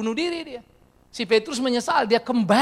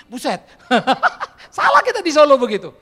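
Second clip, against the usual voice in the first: louder, laughing exclaim; 5 semitones higher, 11 dB louder than usual. Real, not acted. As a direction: laugh at the blunder. Laughs at misunderstanding. (tertawa keras sambil berseru)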